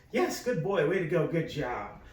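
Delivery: upbeat good-dog voice, starting high; positive good-dog praise. Shown as a demonstration. Trainer praising a dog in positive tone, excited. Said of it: "your positive, good dog voice".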